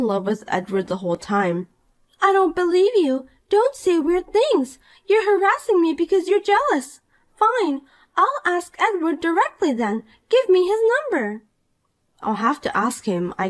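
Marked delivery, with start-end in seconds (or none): 2.2-11.4 put-on a higher, bratty young woman in disbelief; Poppy, indignant. Rival wife-hunter scoffs at the married woman, higher and bratty. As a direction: play the spoiled rival who refuses to believe it.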